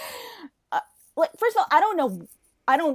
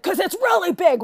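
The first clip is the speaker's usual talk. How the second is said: higher, emphatic; pointed remark; 8 semitones higher, 7 dB louder than usual. Retold or imitated. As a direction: stress the size. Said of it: "I was like".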